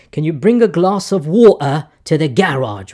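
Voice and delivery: put-on British English with open vowels; fake British accent. Shown as a demonstration. Demonstrating how a Brit would ask for water, fake British accent. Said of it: "try my fake British accent".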